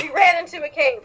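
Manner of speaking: usual speaking voice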